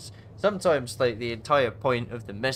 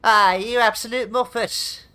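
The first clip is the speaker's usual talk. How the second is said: much higher, much louder playful jeer; cheery banter; 13 semitones higher, 14 dB louder than usual. Shown as a demonstration. Explaining text tone to friends, loud playful banter jeer. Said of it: "if you say".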